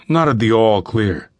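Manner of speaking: usual speaking voice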